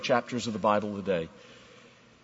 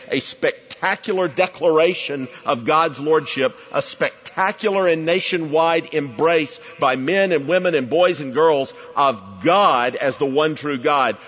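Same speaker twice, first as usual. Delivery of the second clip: raised, driving pulpit voice; impassioned preaching; 7 semitones higher, 7 dB louder than usual. Acted. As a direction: build the list like a revival preacher. Preaches at full force.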